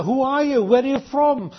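usual speaking voice

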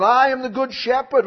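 preacher declaring the verse; emphatic proclamation. Acted. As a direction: proclaim it boldly. Quoting Jesus with force.

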